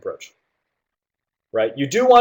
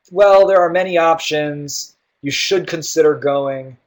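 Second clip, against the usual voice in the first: slow, drawn-out, higher-pitched hedging; hesitant; 4 semitones higher, 4 dB louder, slower than usual. Shown as a demonstration. Teacher demonstrating a hesitant tone to students, hesitant. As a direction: stretch it out as if unsure what to advise. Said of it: "Here's a hesitant tone".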